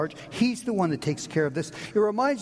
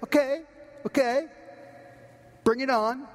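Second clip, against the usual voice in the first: bold declaration; 6 semitones higher than usual. Pretend. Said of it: "you have to say".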